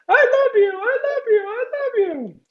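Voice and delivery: very high falsetto shout, fading away; shouted-back echo. A narrator's reading. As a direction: imitate an echo calling back. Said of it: "somebody shouts back".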